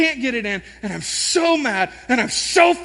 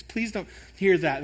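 ordinary delivery

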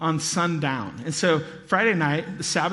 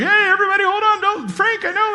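high, loud, hurried calming call; excited rabbi calling out to the room; flustered rabbi; 6 dB louder than usual. Acted. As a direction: rabbi trying to settle the room. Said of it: "the rabbi's like".

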